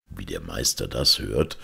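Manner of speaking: usual speaking voice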